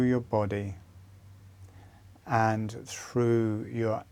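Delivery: gentle fading meditation guide; guided meditation. Acted. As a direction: guide them into calm with long pauses. Leads a meditation.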